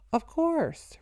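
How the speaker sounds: high gleeful gloat; rejoicing. A narrator's reading. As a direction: crow it with triumph. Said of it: "rejoiced the Nazi".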